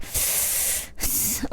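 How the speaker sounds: a quick unvoiced snuffling sniff like a dog; imitated dog sniff. Imitating her dog's weird breath thing, dog sniff. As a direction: sniff through the nose like a poodle. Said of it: "like that".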